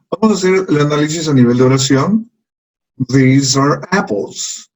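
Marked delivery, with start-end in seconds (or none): 3.1-4.7 slow teacher model with the vowels stretched; falling sentence tune. Shown as a demonstration. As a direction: let "These are apples" glide down from the top. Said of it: "empezamos desde arriba y seguimos descendiendo".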